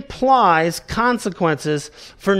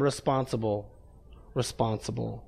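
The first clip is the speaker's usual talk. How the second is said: hushed; 7 semitones lower, 12 dB quieter than usual. Real, not acted. Preacher drops to a hush.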